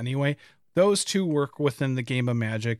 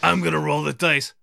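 different voice. Shown as a demonstration. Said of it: "this is what it would be like".

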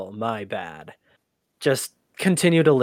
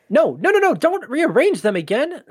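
high, loud burst of frantic no's; alarmed protest; 11 semitones higher, 5 dB louder than usual. Acted. Archivist stopping the computer reshuffling files, alarmed protest. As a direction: stop them urgently, voice leaping up.

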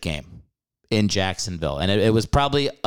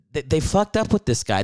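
normal talking voice

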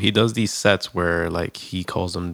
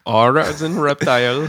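higher, louder customer-service spelling; mock spelling agent; 7 semitones higher, 7 dB louder than usual. Co-host plays agent checking a name, mock spelling voice. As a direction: spell it out loud with a questioning lift.

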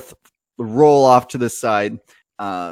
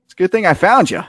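higher, louder folksy twang; high twangy driver greets the heroes; folksy NPC; 7 semitones higher, 4 dB louder than usual. Acted. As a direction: sound pleased at the timing. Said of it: "And he says".

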